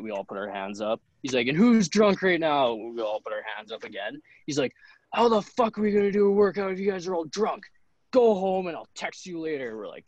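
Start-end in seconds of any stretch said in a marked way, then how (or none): 1.5-2.7 slow, pointed question, higher and louder; mock stern coach grilling; exasperated coach. Coach interrogating hungover team. 5.1-9.8 much higher, exasperated coach outburst; imitated coach losing it at the players; exasperated coach yelling. Coach berating drunk team.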